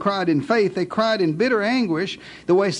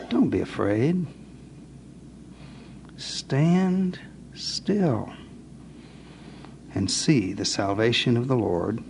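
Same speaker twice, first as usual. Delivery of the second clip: calm Moses; 7 semitones lower than usual. Acted. Moses reassures the frightened people, low and calm. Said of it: "And Moses said to the people".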